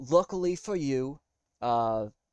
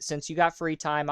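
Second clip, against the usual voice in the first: ordinary talk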